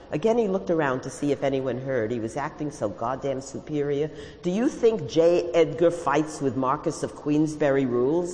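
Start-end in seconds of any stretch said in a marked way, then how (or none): none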